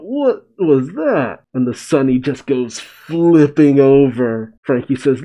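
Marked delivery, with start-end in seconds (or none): 0.0-1.4 high, drawn-out startled cry; mock crying out. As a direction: stretch it out in hammed-up alarm. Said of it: "Frankie cries out".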